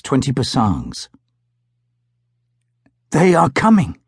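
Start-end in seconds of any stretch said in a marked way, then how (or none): 3.1-4.0 higher-pitched, happy announcement. A narrator's reading. Kuwignaka telling companions of the approach, happy. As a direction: say it happily, voice lifted. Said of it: "had said Kuwignaka happily".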